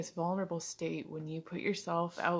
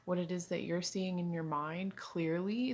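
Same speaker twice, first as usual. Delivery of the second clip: usual speaking voice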